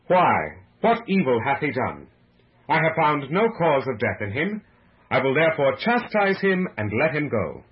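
loud, firm public protest; projected, emphatic oratory. A narrator's reading. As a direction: reason forcefully with a crowd. Pilate argues with the mob.

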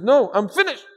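high, loud, emphatic refusal; exasperated retort. Retold or imitated. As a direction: snap it back loudly, imitating a fed-up opponent. Fed-up man's retort in retold dialogue.

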